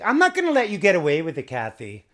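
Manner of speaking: loud, high mock scold; hammed-up high scolding of Kathy; exaggerated shouting Lisa impression. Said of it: "she's like".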